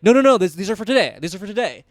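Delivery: retold incredulous objection; disbelief. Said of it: "I was in disbelief".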